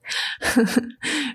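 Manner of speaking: ringing full-throated laugh; hearty laugh. Acted. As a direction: burst out laughing. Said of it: "Saran lachte schallend". (schallendes Lachen)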